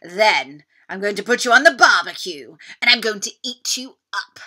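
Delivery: a hungry beast gloating over dinner; greedy dinosaur threat. Acted. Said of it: "yelled the Tyrannosaurus Rex".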